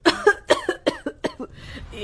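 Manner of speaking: harsh coughing fit from the smoke. Acted. Aunt choking on smoke at the clay stove, coughing.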